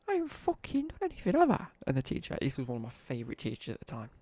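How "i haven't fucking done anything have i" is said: high, squeaky mock outrage; indignant squeaky protest. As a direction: squeal it out like a wronged teen. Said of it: "And I'm like".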